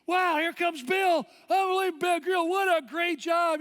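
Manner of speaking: loud, high, stretched-out race cheer; going crazy cheering. Retold or imitated. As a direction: whoop it like an over-the-top finish-line MC. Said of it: "she's going crazy".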